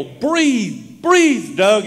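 high, loud pleading yell; retold yell. Mother yelling at her dying son to keep breathing, high pleading yell. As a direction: shout his name to wake him. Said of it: "she'd yell".

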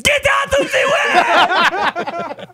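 very high, loud, slow roaring yell; shouted catchphrase. Pretend. Bracket debate, friend bellows the catchphrase. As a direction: belt it out laughing like a promo.

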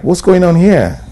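loud, high, alarmed question; startled cry on finding a film set in the bush; shocked exclamation. Retold or imitated. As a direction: blurt it out in sudden shock. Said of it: "And then I was like".

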